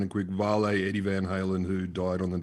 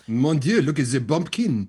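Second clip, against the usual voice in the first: put-on French accent, louder, slow sneer at the bumpkin; mock French aristocrat; 4 semitones higher, 6 dB louder, slower than usual. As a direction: sniff at the peasant like a Paris snob. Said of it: "Parisians loved rustic American".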